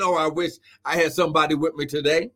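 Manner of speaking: ordinary delivery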